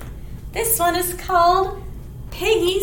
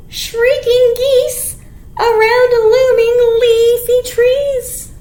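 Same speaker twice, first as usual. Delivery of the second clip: louder, slow, emphatic storybook reading; reading to children, building; 4 semitones higher, 5 dB louder, slower than usual. Acted. Teacher reading the chase scene to kids. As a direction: make the chase exciting. Reading picture book aloud.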